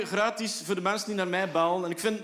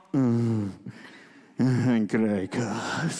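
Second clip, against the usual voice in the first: very slow, low sultry name; porn actor voice; 11 semitones lower, much slower than usual. Retold or imitated. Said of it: "je zegt geen pornoacteur".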